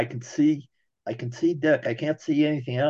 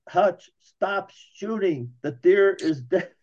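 higher, slow called-out warning, mock yell across a field; retold yell; 6 semitones higher, slower than usual. Hunting buddy calling from the field, a mild mock yell. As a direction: call it out slowly to a friend still firing. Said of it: "And he yells".

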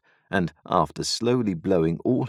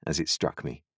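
ordinary delivery

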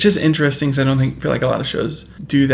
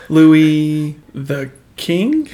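slow, puzzled guess; mock dumb guess; 3 dB louder, much slower than usual. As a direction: drawl it out like a clueless jock. Mocking the jock's answer.

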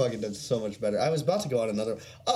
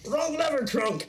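high cartoon cry of "Wrong lever"; mock villain yell; 14 semitones higher than usual. Mocking the presenter's blunder: high cartoon yell. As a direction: play the movie villain's cry. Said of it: "he just pit fell himself".

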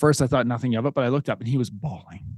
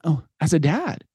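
ordinary talk

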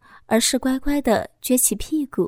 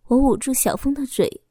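usual speaking voice

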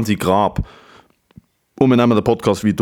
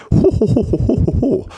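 hearty ho ho laugh; 11 semitones higher than usual. Pretend.

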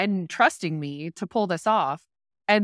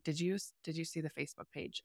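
much softer, hushed worried mother; hushed mom; 3 semitones lower, 16 dB quieter than usual. Retold or imitated. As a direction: murmur it like a mom waking you late. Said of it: "she's like".

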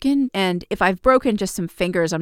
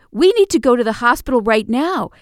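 louder, higher, pressing the point; urgent insistence; 5 semitones higher, 5 dB louder than usual. Retold or imitated. Girlfriend insisting her hurt partner go to hospital, urgently. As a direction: repeat it with more force and alarm.

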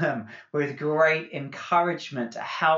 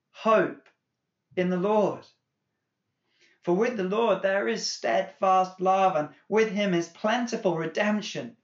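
high, loud, fervent reading of the psalm; impassioned scripture reading; 7 semitones higher, 4 dB louder than usual. Acted. Pastor reading the psalm to the church, impassioned. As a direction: cry the words up to God from the pulpit.

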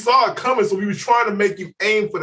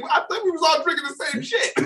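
high amused reply; 10 semitones higher, 4 dB quieter than usual. Retold or imitated. Narrator's reply.

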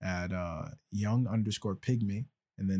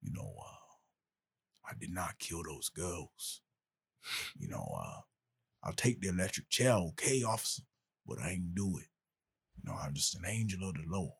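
put-on Tuscaloosa accent; 2 semitones lower than usual. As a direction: play a drawling Tuscaloosa convict. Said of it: "would you prefer I sound like I'm from Tuscaloosa".

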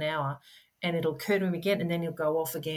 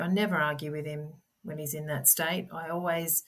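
ordinary talk

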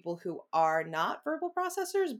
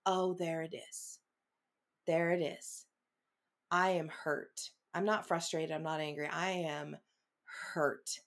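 quiet realization; 2 semitones lower, 6 dB quieter than usual. Retold or imitated. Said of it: "and I'm like".

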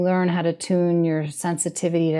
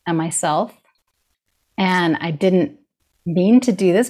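usual speaking voice

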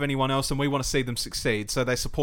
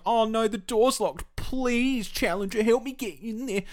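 high, slow whiny plea for help; mock pleading secretary; 9 semitones higher, slower than usual. Fan mocks the secretary begging the champion, high whiny plea. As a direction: ham up a helpless damsel.

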